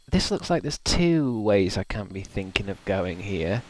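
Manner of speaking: ordinary talk, nothing marked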